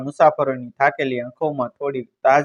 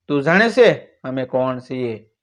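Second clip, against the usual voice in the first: ordinary delivery, nothing marked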